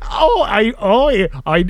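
ordinary delivery

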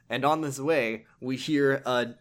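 ordinary talk

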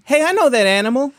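high, playful jingle-like delivery; hammy game show title; game title announcement. Acted. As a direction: announce it way up high, stretching the end.

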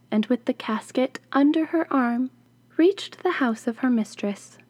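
plain reading voice

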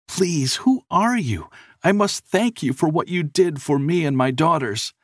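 high-pitched earnest plea; pleading with grateful urgency; begging. A narrator's reading. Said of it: "the man begged".

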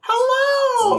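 very high, screechy dog voice. Shown as a demonstration. Player slipping from female snake into dog voice, very high screech. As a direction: pitch it way up into a squeal. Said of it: "that's my dog voice".

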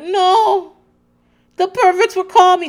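high, loud mock wail; hammed-up, badly faked accent in a panic; bad accent imitation. Mama Elsa protesting on the phone, mock-distraught. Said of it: "this is not the accent".

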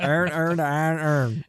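mock Baltimore accent, vowels blurred; "Iron earned iron earn" drawled Baltimore style. Joining the joke, in a mock Baltimore accent. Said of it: "Baltimore guys are like".

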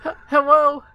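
high silly voice. Acted.

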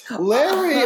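high shrieking call; screaming. Retold or imitated. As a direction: shriek his name way up high. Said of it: "Helen starts screaming".

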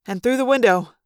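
plain reading voice

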